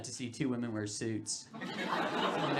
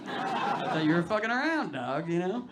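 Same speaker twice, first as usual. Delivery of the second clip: high, slow and laughing through it; laughing concession; 5 semitones higher, 3 dB louder, slower than usual. Retold or imitated. Speaker to his dealer, laughing as he concedes. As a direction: admit he got you, giggling.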